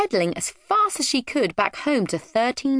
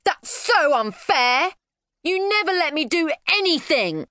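put-on high, whiny child tantrum; indignant protest; 11 semitones higher than usual. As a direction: wail at your parents, dragging out the words. Child protesting after parents refuse.

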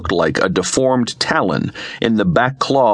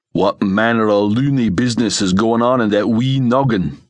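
broad Scots comrade questioning a mad plan; Scots sidekick, doubtful. Acted. Alex frowns at his friend's scheme, sceptical Scots voice. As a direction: put on a puzzled Scottish sergeant.

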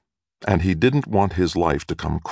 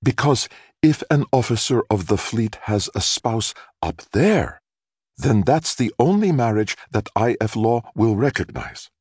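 mother's voice; 6 semitones higher than usual. A narrator's reading. Mother explains to neighbors.

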